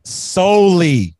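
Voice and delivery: incredulous, slow repeat; drawn-out echo. Real, not acted. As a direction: stretch the word out slowly, loud. Echoing a word in disbelief.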